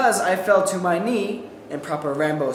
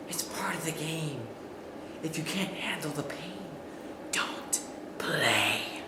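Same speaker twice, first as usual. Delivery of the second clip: soft, lower, cold taunt fading to a whisper; low, quiet menacing reply; 5 semitones lower, 14 dB quieter than usual. Retold or imitated. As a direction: say it quietly like a smug bully.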